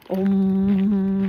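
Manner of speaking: a long, sustained meditation om; deep om hum. Shown as a demonstration. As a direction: hum a deep om to feel the vibration. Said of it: "a really deep".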